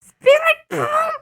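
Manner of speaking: very high screaming anime hero; Goku shout. Retold or imitated. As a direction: yell it like Goku. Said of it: "He's like".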